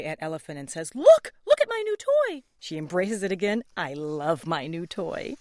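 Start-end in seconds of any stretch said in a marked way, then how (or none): 0.9-2.4 very high, loud, exuberant shout; Piggy proudly shows off her toy; excited Piggy. Acted. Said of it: "starring [name] as Piggy". 3.8-5.4 soft, slow, loving; hugging the toy, say it gently; tender Piggy. Acted. Piggy hugs her toy again.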